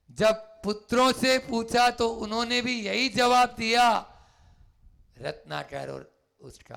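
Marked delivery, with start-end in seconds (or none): 0.1-4.0 raised voice. A narrator's reading. Preacher raises voice narrating.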